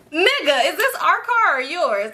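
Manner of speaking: exasperated. Real, not acted. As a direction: throw it back at him in disbelief. Exasperated retort about driver.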